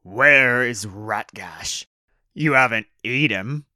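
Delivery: loud, slow orc interrogation; suspicious orc boss. Acted. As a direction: demand answers as a towering orc. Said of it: "The towering orc looked round suspiciously".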